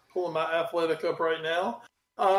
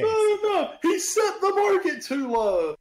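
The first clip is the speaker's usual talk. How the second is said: mock outraged unionist; 12 semitones higher, 3 dB louder than usual. Mocking union critics of the deal, loud mock outrage. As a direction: shout like a scandalised union rep.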